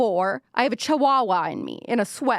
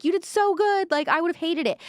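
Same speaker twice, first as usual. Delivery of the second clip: sweet fan voice; 9 semitones higher than usual. Retold or imitated. Quoting sweet supportive fans.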